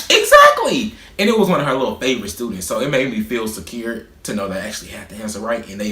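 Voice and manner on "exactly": a high, loud, victorious exactly; triumphant exactly. Retold or imitated. Cheering on a classmate against the teacher, triumphant. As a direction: crow it like you just won. Backing up his right answer.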